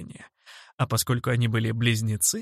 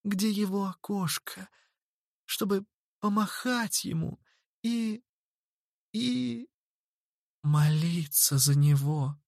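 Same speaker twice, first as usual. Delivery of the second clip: hesitate, then rush in; pleading girl voice; 7 semitones higher than usual. A narrator's reading. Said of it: "быстро пришла на помощь Анджела".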